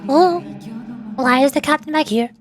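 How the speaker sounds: normal talking voice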